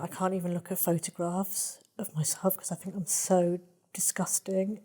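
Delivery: hushed, emotional self-disgust; soft, painful confession; real emotion. Real, not acted. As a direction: let the voice sink almost to a whisper as the shame comes up. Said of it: "I'm feeling the emotion when I say it".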